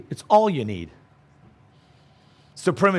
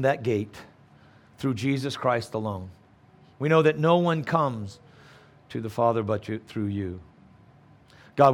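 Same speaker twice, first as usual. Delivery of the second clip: closing prayer; 3 semitones lower than usual. Acted.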